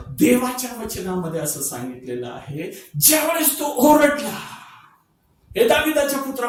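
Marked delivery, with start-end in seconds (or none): 3.0-6.5 louder, higher preaching cry; preaching burst. Acted. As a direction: lift the voice to the congregation. Preaching burst on the blind man's cry.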